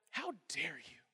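hushed, low imitation of indignation; quietly mocking outrage; mock affronted. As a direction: drop to a soft, theatrical affront. Mimics people acting affronted.

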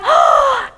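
a high astonished intake of breath; awed gasp at a revelation; sharp high gasp. Retold or imitated. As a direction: gasp as it suddenly hits you. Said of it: "and I'm over there like".

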